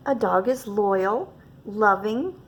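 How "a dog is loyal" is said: plain reading voice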